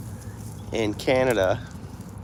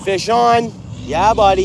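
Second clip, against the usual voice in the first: raised, higher voice calling across; friendly call-out; 6 semitones higher than usual. Real, not acted. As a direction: hail the trucker cheerfully. Calling out to passing truck driver.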